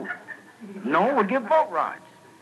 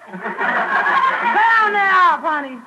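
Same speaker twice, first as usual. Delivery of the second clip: high, loud squeals stretched out; screaming fan; 12 semitones higher, 5 dB louder, slower than usual. Acted. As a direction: cheer him on in a high screaming voice. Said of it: "They'd scream things like".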